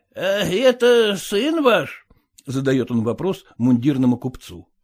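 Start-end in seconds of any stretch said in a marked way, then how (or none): none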